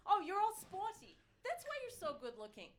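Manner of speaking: high loud mock amazement; mock impressed. Host mocking the sporty crowd, loud fake awe. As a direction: gush at them in fake awe.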